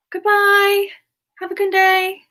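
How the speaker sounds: bright, high, loud sign-off; cheery goodbye. Real, not acted. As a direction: wave them off with a big happy goodbye. Cheery farewell to a chatter.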